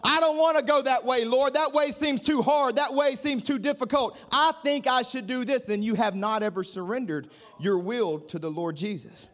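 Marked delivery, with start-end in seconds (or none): 0.0-5.6 whiny complaining believer. Retold or imitated. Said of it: "we're over here going".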